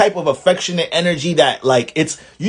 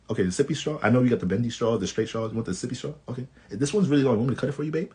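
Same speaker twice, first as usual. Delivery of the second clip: put-on much softer, doting baby talk; mushy partner voice; 9 dB quieter, much faster than usual. Taurus pampering Pisces with juice and straws, much softer baby talk. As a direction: coo over her like she's a toddler. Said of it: "mushy as hell".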